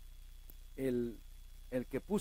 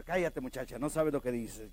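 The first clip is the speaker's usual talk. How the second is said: dismissive scolding; 7 semitones lower than usual. Acted. Acts the others hushing Rhodes.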